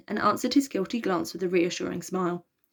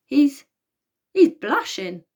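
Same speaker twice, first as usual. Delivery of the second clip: surprised; 6 semitones higher, 8 dB louder, much slower than usual. Acted. Hermes surprised at Elliot.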